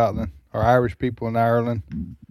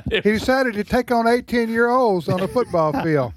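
higher-pitched joking family voices with some laughter; mock puzzled grandchild; 8 semitones higher than usual. Host imagining family asking how Grandpa died, mock-puzzled higher voice. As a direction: lightly play a baffled grandkid. Acting out grandchildren discussing the death.

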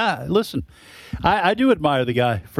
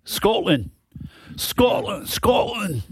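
put-on Scottish accent, pitched up; 6 semitones higher, much slower than usual. Co-host mimics the country's name, hammed-up Scottish accent. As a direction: playfully exaggerate a Scottish accent. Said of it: "doing my Scotland accent".

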